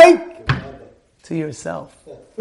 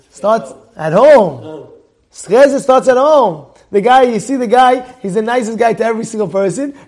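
usual speaking voice

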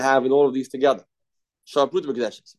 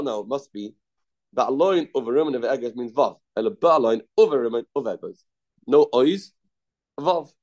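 ordinary talk

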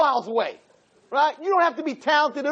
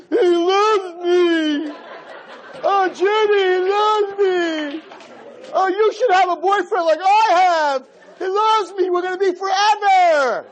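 mock gushing girl; 7 semitones higher than usual. Girl bragging to friends about her boyfriend, mock swooning. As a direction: ham up a lovestruck girl bragging.

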